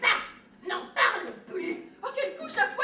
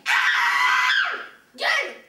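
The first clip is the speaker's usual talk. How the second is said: loud, much higher raging yell; furious shout; 10 semitones higher, 8 dB louder, much slower than usual. Acted. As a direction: explode and scream him down. Son explodes at brother.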